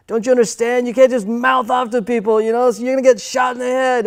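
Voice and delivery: high, loud, angry imitation of dad. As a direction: play the furious father shaking sense into his son. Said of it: "he got so angry, he was like".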